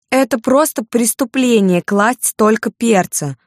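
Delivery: slow, high-pitched indignant complaint; high-voiced, drawn-out outrage; angry scolding. A narrator's reading. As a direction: scold the waiter, stretching out every word. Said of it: "сердито выговаривала она официанту".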